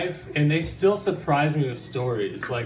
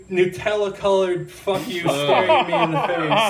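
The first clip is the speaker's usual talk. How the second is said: laughing; 4 semitones higher, 4 dB louder than usual. Real, not acted. Laughing at gross memory.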